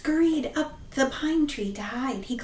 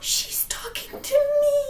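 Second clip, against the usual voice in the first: high panicked squeak; high-pitched frightened old man; terrified old man; 12 semitones higher than usual. Acted. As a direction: gasp it out in fear.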